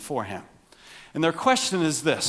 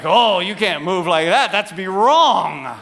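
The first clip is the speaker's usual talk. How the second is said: mock scolding; 4 semitones higher, 9 dB louder than usual. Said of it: "It was like".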